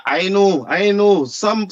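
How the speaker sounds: ordinary talk, nothing marked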